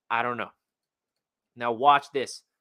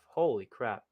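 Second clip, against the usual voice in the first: low, hushed amazement; soft stunned holy crap; quiet awe; 7 semitones lower, 6 dB quieter than usual. Real, not acted. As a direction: breathe it out, stunned. Repeats after watching blocks.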